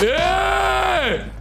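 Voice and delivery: very high, long-held shout; drawn-out cry of outrage; exasperated yell. Real, not acted. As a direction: stretch it into one high holler. Fed-up outburst after losing.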